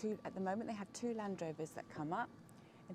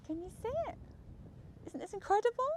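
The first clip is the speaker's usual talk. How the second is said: excited; 10 dB louder, faster than usual. Real, not acted. Showing off the view.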